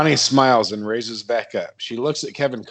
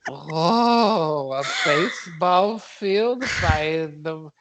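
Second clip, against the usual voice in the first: put-on higher, slow wide-eyed kid voice; childlike Todd; 5 semitones higher, much slower than usual. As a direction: marvel slowly like an odd child. Todd voiced marvelling at field.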